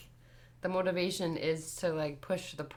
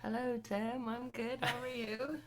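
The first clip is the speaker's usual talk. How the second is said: accidental British lilt; 5 semitones higher, 9 dB quieter than usual. Real, not acted. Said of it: "Did you just turn British?".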